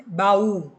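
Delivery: Baú with the final ú punched loud; stressed final u. Shown as a demonstration. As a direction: hit the ú of Baú hard, like a teacher showing stress. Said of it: "o u é a sílaba mais forte". (ênfase na sílaba tônica: baÚ)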